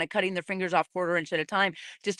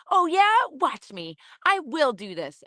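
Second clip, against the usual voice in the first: put-on defiant little girl; 7 semitones higher than usual. A little girl answering her critics, defiant. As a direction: play a stubborn four-year-old who won't back down. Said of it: "I was like the little four-year-old little girl".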